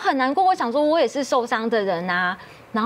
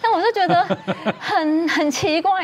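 laughing; 7 semitones higher than usual. Real, not acted. Laughing at odd wedding.

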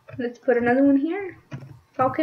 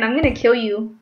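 ordinary delivery

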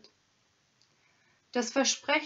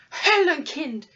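howled out, higher and louder; howling in rage; 5 semitones higher, 9 dB louder than usual. Acted. Hermit cursing the robber, howling in rage. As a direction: hurl it at him beside yourself. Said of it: "heulte der heilige Mann außer sich".

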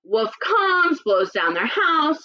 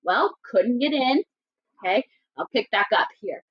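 ordinary talk, nothing marked

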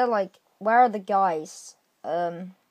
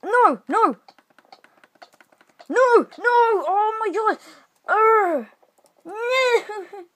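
dismayed; 10 semitones higher, much slower than usual. Real, not acted. Dying in the game.

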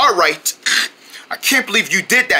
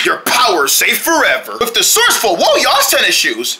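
hyped ad announcer. Acted. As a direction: sell the shoes with hype. Fake sneaker commercial read.

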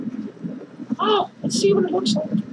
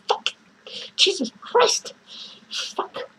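ordinary talk, nothing marked

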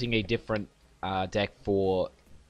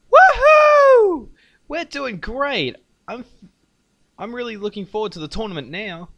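excited cheerful voice; 13 semitones higher, 11 dB louder than usual. Acted. Reads game character's lines.

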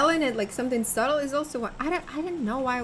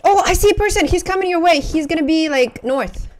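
loud, high burst calling out to a teammate; urgent callout; 6 semitones higher, 12 dB louder than usual. Real, not acted. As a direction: shout out an enemy sighting. Calling out enemy position to teammate.

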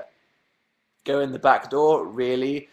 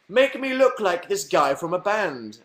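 mock hopeful customer; 8 semitones higher, slower than usual. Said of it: "and I'm like".

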